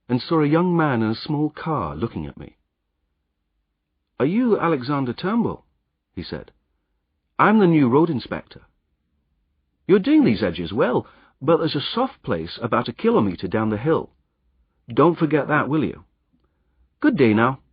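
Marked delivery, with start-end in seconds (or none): 4.2-5.7 lightly voiced brisk official, higher; a busy inspector checking on a worker; road inspector. Inspector questions the disguised roadman, brisk and higher. 7.4-17.6 lightly voiced brisk official, higher; a busy inspector checking on a worker; road inspector. Inspector questions the disguised roadman, brisk and higher.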